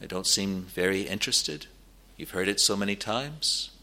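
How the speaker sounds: low, soft, personal pleading; hushed appeal with long pauses; gentle pulpit appeal. Real, not acted. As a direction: speak quietly to one friend.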